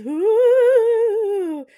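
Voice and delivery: long, high, loud "woo"; hammed-up ghostly wail; banshee howl. Hotel worker playing banshee above the tourists, loud mock wail.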